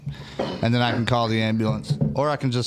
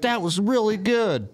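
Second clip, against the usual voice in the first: high, drawn-out mock female praise; impressed girl; 10 semitones higher, slower than usual. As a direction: imitate her amazed approval, high and slow. Retells the tricked girl's praise.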